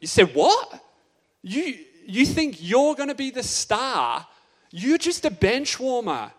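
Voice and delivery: mock the little brother, mildly furious; angry brothers. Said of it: "but they were angry".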